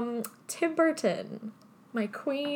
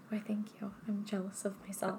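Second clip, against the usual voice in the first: whispering; 12 dB quieter than usual. Pretend. Jokingly thanks herself, hushed.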